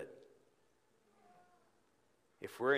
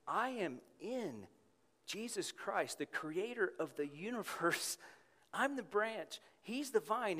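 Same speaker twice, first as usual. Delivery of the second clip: inner affirmation; 10 semitones higher than usual. Shown as a demonstration. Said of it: "think to yourself".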